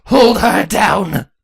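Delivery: loud, slow command; ordering shout. A narrator's reading. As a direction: bellow the order. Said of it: "Chrissy ordered".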